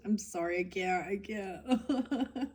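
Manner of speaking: breaking up laughing mid-sentence; can't get the words out for laughing. Real, not acted. Laughing fit over weird kinks.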